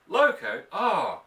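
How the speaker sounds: grunted. Acted. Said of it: "McLaughlin grunted and frowned".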